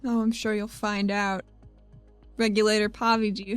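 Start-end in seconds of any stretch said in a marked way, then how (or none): none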